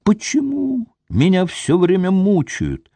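high, despairing wail; desperate cry. A narrator's reading. Tormented wife cries out at her jealous husband, desperate and high. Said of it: "отчаянно закричала она".

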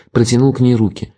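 plain reading voice

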